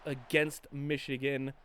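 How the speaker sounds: usual speaking voice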